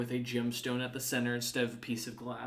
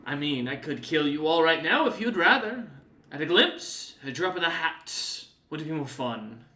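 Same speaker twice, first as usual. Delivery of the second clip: a hint of a playful, louder threat; lightly voiced menace; 3 semitones higher, 6 dB louder than usual. Demon casually threatens to kill the party, lightly menacing. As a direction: toy with them.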